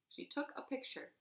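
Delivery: plain reading voice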